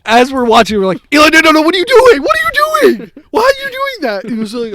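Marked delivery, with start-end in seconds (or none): 1.1-4.2 high, loud, rushed outcry; alarmed yelling. Retold or imitated. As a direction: yell in mock horror to stop a friend. Acted group yelling at Eli.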